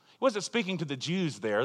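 ordinary talk, nothing marked

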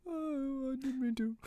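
high meek apology; pleading apology. Retold or imitated. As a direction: whine like caught kid. Jason voiced as apologetic.

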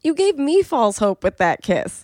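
high, loud mock protest; indignant retort. Viewer answering Adam about the kiss, mock indignant. Talking back to contestant's quoted remark.

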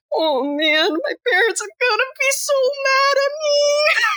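very high, whiny sobbing voice; hammed-up tearful teenager wailing; mock crying wail. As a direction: imitate a blubbering killer, rising to a squeal. Acting out Stu crying over parents.

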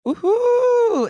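high, whooping cheer of joy; bright celebratory woohoo as you arrive; excited cheer. Real, not acted. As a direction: let out a big happy whoop.

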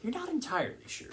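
ordinary delivery

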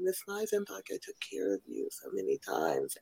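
softly imitated feminine voice; a quiet impression of a woman's distinctive voice; feminine nurse voice. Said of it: "like, a very feminine".

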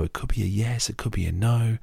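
ordinary talk

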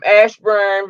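normal talking voice

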